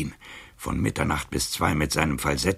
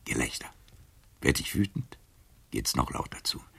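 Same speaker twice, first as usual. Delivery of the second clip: ordinary talk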